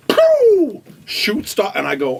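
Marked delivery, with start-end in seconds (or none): 0.1-0.8 loud, high "pew" gun noise; explosive high mouth-made shot; imitated gunshot. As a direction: fire off a vocal gunshot.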